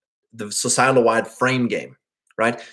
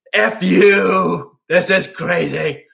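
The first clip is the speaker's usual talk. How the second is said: loud angry outburst, then slow; mock anger; 5 semitones higher, 4 dB louder, much slower than usual. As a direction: snap it out like an angry man. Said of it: "And then anger".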